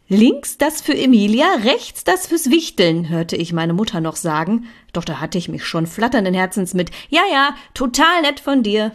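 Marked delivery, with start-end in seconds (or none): 0.1-3.1 calling after someone. A narrator's reading. Mother explaining which present is which, calling after. 7.1-8.9 high, loud, hurried thanks; breezy brush-off. A narrator's reading. Saying goodbye to mother at the door, dismissive.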